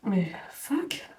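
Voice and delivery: frustrated whisper under breath; whispered curse. Real, not acted. Whispered curse at stuck tin.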